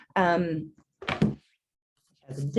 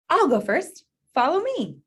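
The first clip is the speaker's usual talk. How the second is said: high, loud call to the others; bossy leader shouting "follow me"; shouted out; 10 semitones higher, 5 dB louder than usual. Acted. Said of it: "Blue shouted out".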